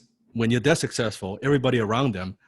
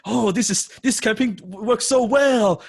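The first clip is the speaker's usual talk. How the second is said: much higher, louder, slowing into a gloat; boastful mock; 12 semitones higher, 6 dB louder, slower than usual. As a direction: ham up a bragging client pitch. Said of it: "don't be".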